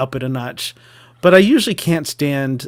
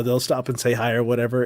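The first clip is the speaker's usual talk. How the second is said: ordinary talk, nothing marked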